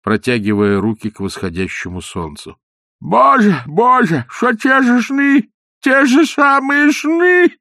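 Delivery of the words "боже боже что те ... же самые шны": high, slow wailing lament, louder; old man's lament to the rising sun; weeping old man. A narrator's reading. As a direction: wail it through tears like a toothless old man. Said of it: "старик заплакал".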